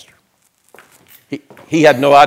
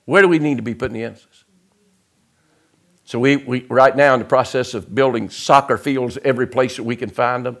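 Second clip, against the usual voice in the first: ordinary talk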